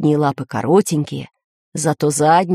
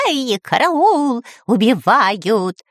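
high, drawn-out mock cry for help; Кутя-Плютя crying out; 5 semitones higher, much slower than usual. A narrator's reading. Parrot hit by a flying boot, crying out. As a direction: wail it slowly, pitch falling away. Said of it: "закричал Кутя-Плютя".

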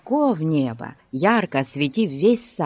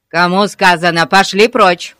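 loud, angry police bark; barked order; 10 dB louder than usual. A narrator's reading. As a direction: bark it to drive the children off. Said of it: "рявкнул мистер Гун". (рявкнуть сердито)

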